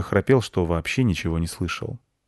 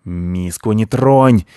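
slow, loud warning growl; guard the bowl, slow and menacing; bristling cats; 8 dB louder, much slower than usual. Acted. Cats bristle and warn the squirrel off their bowl. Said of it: "ощетинились коты".

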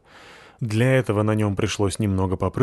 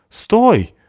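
much higher and louder shout; shouted stop; 13 semitones higher, 8 dB louder than usual. A narrator's reading. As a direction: yell to make him halt. Cat blocks the hedgehog.

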